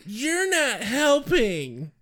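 high, slow, whining complaint; whiny voice. Retold or imitated. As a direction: drag it out in a whine. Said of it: "it really should be read in the whiny voice".

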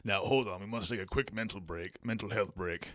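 low, grave British villain; evil genius with mock menace; Bond villain voice. Continues Bond villain bit.

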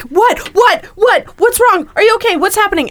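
high, loud, frantic questions; jolted awake and scared, firing questions; retold panic. Woken by partner's screams, panicked high loud questions. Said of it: "Like, I was terrified".

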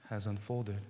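ordinary talk, nothing marked

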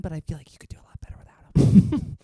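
secretive whisper. Acted. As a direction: whisper it like gossip. Confiding aside about Tony.

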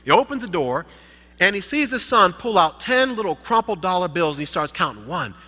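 ordinary talk

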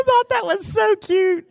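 high and loud, still giggling; laughing. Real, not acted. Preacher's wife amused by the church lady, laughing. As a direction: squeeze the words out through laughter.

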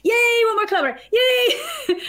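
squealed high, cheering yay; celebrating. Retold or imitated. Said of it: "I'm celebrating at every kilometer".

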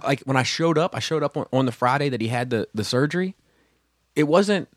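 ordinary talk, nothing marked

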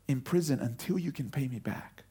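low cold whisper; the unforgiving servant whispering his cruel verdict; whispered threat. Acted. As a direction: hiss the sentence under your breath.

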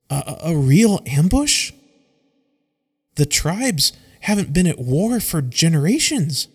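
a nervous trainee stumbling over the first word; shaky nervous. Acted. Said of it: "with a shaky, nervous voice".

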